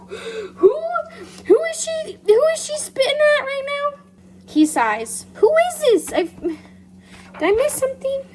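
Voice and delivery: squeaky loud disbelief; high-pitched outrage. Real, not acted. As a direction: go high in shock. Outraged, confused.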